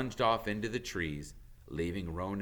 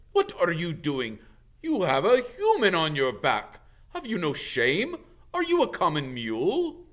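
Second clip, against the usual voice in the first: loud, higher outraged scolding; thundering; 8 semitones higher, 8 dB louder than usual. Acted. Bane thundering at Firenze for carrying a human. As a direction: roar your disgust at him. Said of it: "Bane thundered".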